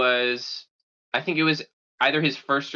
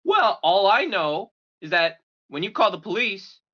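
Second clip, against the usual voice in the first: higher mock dad voice breaking into laughter; menacing dad, laughing; 7 semitones higher, 5 dB louder than usual. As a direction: threaten playfully through a laugh. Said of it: "He's like".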